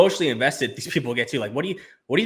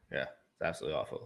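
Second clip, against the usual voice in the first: much lower and softer; low disgusted; 9 semitones lower, 14 dB quieter than usual. Real, not acted. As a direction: mutter it in disgust.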